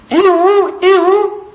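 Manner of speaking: high, birdlike ihu, ihu; a bird calling the flock, high and bright. Acted. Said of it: "some of the birds are saying".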